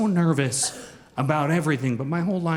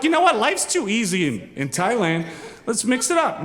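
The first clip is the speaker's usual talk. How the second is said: mock bold; 6 semitones higher than usual. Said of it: "but we were like".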